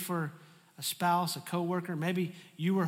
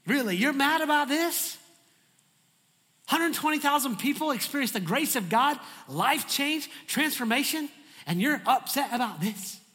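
incredulous scolding; 7 semitones higher, 5 dB louder than usual. Acted. Preacher scolding Jonah incredulously.

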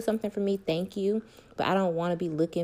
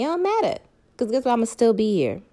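higher and louder, defiant; emphatic declaration; 4 semitones higher, 6 dB louder than usual. Real, not acted. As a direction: proclaim it, proud and defiant. Emphatic self-love declaration.

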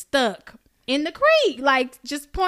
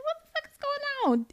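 high-pitched, softer mock alarm; retold bewilderment; 6 dB quieter than usual. Telling friend of a snapped tree, high mock disbelief. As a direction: squeal it in comic disbelief.

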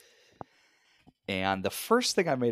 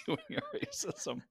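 laughing; 9 dB quieter than usual. Real, not acted. Laughing repeat of partner's phrase.

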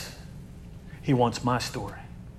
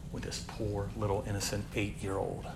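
1 hushed and grave; 6 semitones lower, 15 dB quieter than usual. Real, not acted. Grim detail of child's abuse.

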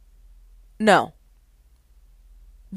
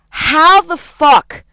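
slow, drawn-out, louder outburst; exasperated how the fuck; 4 semitones higher, 4 dB louder, much slower than usual. Real, not acted. Venting to viewers about the class average, slow angry disbelief. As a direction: stretch out each word in disbelief.

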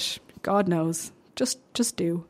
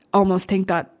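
ordinary delivery

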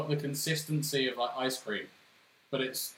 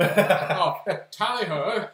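posh English; 3 semitones higher, 6 dB louder, much slower than usual. Retold or imitated. Mocking posh English stereotype.

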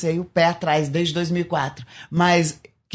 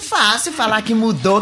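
ordinary talk

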